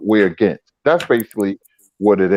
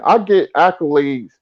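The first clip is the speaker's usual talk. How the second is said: ordinary talk, nothing marked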